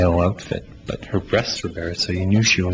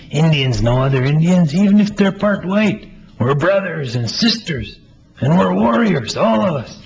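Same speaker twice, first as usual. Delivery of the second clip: high, loud, eager voice; 9 semitones higher, 4 dB louder than usual. Acted. Playwright voices the eager friend reassuring Melvin, high and loud. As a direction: answer like it's obvious, bursting with certainty.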